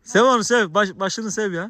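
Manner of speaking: high, loud encouragement; loud urging. Real, not acted. Urging villager to pet dog. (yüksek sesle teşvik etme)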